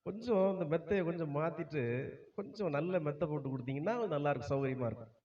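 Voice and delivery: soft, fast spoiled whining; lazy complaining. Retold or imitated. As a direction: whine softly like a pampered new king. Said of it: "அவன் என்ன செய்யறான்?".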